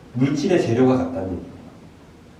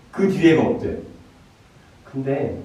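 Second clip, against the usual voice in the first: ordinary talk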